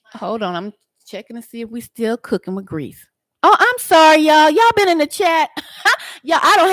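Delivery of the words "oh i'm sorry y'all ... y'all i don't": high, loud burst of real surprise; startled, apologetic outburst, high and loud; surprised apology. Real, not acted. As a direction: exclaim it as you suddenly notice them. Discovers the neglected chat, apologizes.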